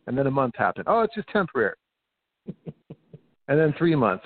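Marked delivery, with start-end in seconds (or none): 0.9-1.8 higher, sing-song dismissive oh; mocking what authorities kept saying, higher sing-song; mocking parody. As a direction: parody the officials' reassurance. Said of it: "I remember them saying".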